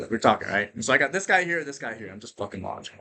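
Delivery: ordinary talk, nothing marked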